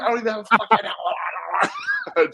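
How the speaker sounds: high, wild coach yell; bleeding coach yelling. Retold or imitated. Bleeding coach yells at players about his missing helmet, wild yell. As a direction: shout it like a crazed coach with a bloodied face. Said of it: "He's like".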